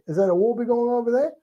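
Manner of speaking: very high, rising Aussie question; high-pitched mock Aussie echo; kids copying Australian accent. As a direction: imitate schoolkids parroting an Australian accent. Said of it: "And they say".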